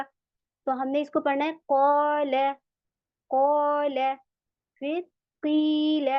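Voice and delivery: ordinary talk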